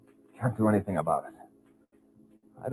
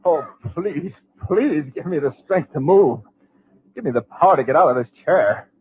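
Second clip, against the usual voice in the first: higher, pleading prayer in terror; a trapped man praying to escape; desperate plea; 7 semitones higher, 5 dB louder than usual. Acted. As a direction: beg for the strength to move. Trapped narrator begs for strength.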